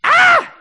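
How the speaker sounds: piercing, very high shriek; toddler's scream. Retold or imitated. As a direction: imitate a screaming toddler, loud and sudden. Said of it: "this is how he starts screaming at me".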